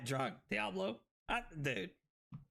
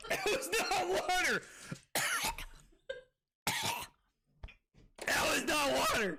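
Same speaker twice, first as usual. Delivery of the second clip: much higher, laughing yell of protest; laughing shout; 12 semitones higher, 3 dB louder than usual. Real, not acted. Streamer tricked by his friend, laughing protest. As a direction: howl it through laughter.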